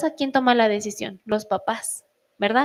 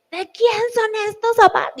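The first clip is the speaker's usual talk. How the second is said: higher, louder exasperated demand; mock angry scolding; 10 semitones higher, 4 dB louder than usual. Mother scolding her kids about shoes, higher hammed-up anger. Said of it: "y empiezo así de".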